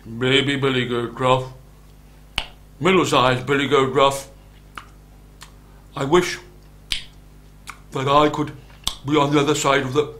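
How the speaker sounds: big goat calling out in measured phrases; solid, deliberate big goat voice; big billy goat. A narrator's reading. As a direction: speak as the biggest goat, with weighty pauses. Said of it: "And he said".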